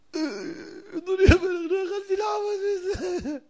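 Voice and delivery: high, slow, teary plea; tearful confession. Acted. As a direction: beg forgiveness through mock tears. Konstantin confessing to the king.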